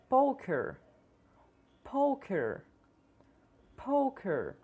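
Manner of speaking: poker with a long oh; English vowel. Shown as a demonstration. Teacher models poker's long o for students. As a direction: stretch the o of poker. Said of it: "Tente repetir as palavras".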